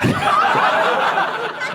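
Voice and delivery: breathless high-pitched giggle; high wheezing laugh. Real, not acted. Comic laughing at his own punchline, high wheeze.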